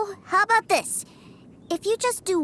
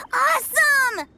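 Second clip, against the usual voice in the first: excited cheer; 6 semitones higher, 4 dB louder, slower than usual. Acted. Kid arriving at toy institute.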